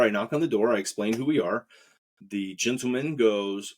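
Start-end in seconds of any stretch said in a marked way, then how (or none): none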